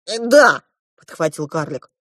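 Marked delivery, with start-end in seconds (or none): 0.1-0.6 raised, loud dwarf agreement; eager dwarf. A narrator's reading. The dwarf seconds the idea, eager. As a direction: chime in high and loud.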